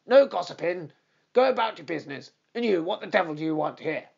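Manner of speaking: loud, fast bullying trainer; fierce old trainer ordering the groom off, loud and angry. Acted. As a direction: snap orders and drive the strangers away. Trainer drives them off.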